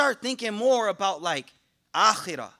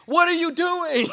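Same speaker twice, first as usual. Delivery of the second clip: higher, playful imitation of a puzzled wife; mock wife; 7 semitones higher, 4 dB louder than usual. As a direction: lightly voice the wife asking what he's doing. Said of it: "Like my wife".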